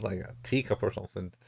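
ordinary talk

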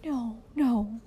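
soft, anxious denial; frightened murmur. A narrator's reading. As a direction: murmur it fearfully, backing down. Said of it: "murmured the frightened woman".

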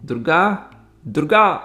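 father shouting for his daughter Durga; loud shout. Acted. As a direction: bellow the name across the office. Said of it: "అని గట్టిగా అరిచాడు గురుమూర్తి".

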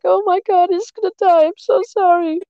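high panicked child's wail, imitated; crying kid. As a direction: play a four-year-old crying in panic. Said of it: "I was like".

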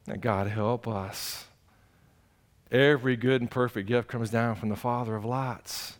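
soft, hushed prayer to God; soft humble prayer; quiet prayer. Acted. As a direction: pray low and intimate, almost to yourself. Pastor prays aloud.

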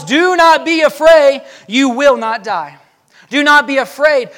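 loud, slow proclamation; booming reassurance from the pulpit; proclaiming. Acted. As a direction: declare it slowly and boldly. Preacher booms God's reassurance.

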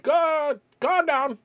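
high-pitched exasperated outburst; exasperated plea. Retold or imitated. Reacting to a man yelling at airline lady, high exasperation. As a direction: cry it out high over the rant. Comic reply to yelling passenger.

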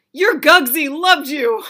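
high, loud and slow grandma voice; slow, loud loving grandma; grandmother voice. Retold or imitated. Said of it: "She's like".

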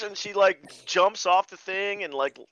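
usual speaking voice

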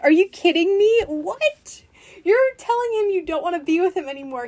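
high, loud disbelief; incredulous outrage. Real, not acted. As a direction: indignantly call out the hypocrisy. Indignant reaction to quoted woman.

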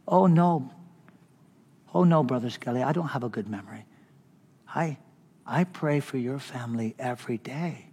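tender humble reply. Retold or imitated. Vic humbly explains his prayers.

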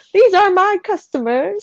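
lightly mock the kid showing off; little boy. Co-host quoting the kid at his cafe, little boy. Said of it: "he sits there with the customers".

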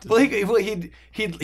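ordinary talk, nothing marked

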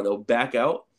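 normal talking voice

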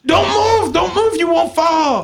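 high, shouted command; hammed-up panicked order; mock frantic warning. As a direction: yell it urgently, pitch way up. Acted screaming attendant ordering him.

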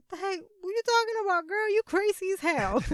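high, quick mock-baffled protest; imitated indignant denial. Imitating his indignant denial in story.

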